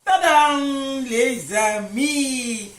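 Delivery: drawn-out triumphant tadam; french chef. Real, not acted. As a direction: announce the reveal proudly, stretched out. Grand reveal of baked clafoutis.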